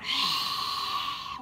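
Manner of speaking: long, forceful dragon exhale; big audible whooshing exhale; fire breath out. Shown as a demonstration. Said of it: "then fire breath out".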